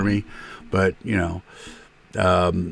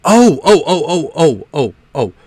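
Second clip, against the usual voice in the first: excited; 6 semitones higher than usual. Real, not acted. Sudden excited reaction.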